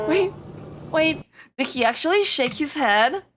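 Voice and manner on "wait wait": normal talking voice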